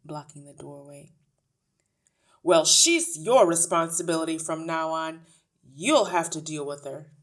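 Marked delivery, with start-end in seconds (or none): 2.4-7.1 loud, dismissive ratty man; brusque father. A narrator's reading. Father hands responsibility to the teacher, brusque. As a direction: push the problem loudly onto her.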